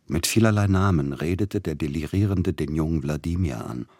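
usual speaking voice

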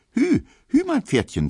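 put-on a little boy whooping as he rides his stick horse; jubilant child. A narrator's reading. Child on a stick horse cheering to his father, high and jubilant.

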